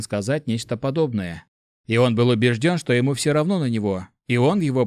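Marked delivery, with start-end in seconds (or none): none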